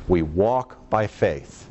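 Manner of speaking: ordinary delivery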